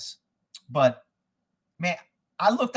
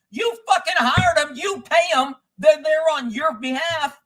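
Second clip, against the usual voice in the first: high-pitched, emphatic angry rant; pissed off; 8 semitones higher, 3 dB louder than usual. Real, not acted. Fed-up customer blasting businesses that blame contractors, pissed off. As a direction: hit each point hard, pitched way up. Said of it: "So I was freaking pissed".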